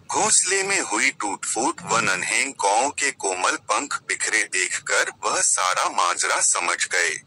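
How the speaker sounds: ordinary delivery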